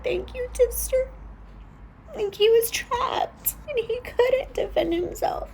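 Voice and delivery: choked-up, high, tearful voice; crying. Real, not acted. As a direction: speak through real tears. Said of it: "I've been crying".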